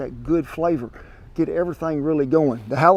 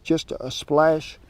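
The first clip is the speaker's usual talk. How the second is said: ordinary delivery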